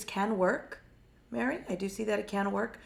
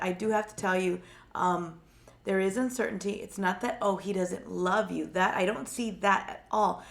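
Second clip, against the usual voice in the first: ordinary talk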